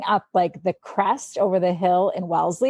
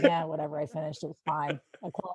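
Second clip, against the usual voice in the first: much softer, lower, fading bored tone; bored shrug; 4 semitones lower, 9 dB quieter, faster than usual. Retold or imitated. Mimicking her own dull race stories, a soft bored shrug. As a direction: toss it off as if nothing happened.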